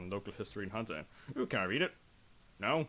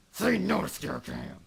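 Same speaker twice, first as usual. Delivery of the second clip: higher, louder slogan shout, slowed; mock slogan; 10 semitones higher, 7 dB louder, slower than usual. Gamer rallying viewers against scare cams, mock slogan shout. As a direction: proclaim it like a campaign chant.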